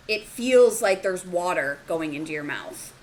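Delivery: ordinary talk, nothing marked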